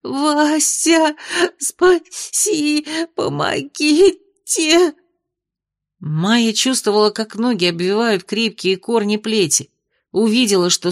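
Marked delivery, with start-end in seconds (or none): 0.0-4.9 panicked babbling. A narrator's reading. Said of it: "бессвязно бормотала".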